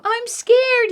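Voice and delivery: put-on high, small frightened child voice; scared little boy. Eddie telling Mom he's scared, high and frightened. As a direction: squeak it out like a scared kid. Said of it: "Eddie doesn't want to".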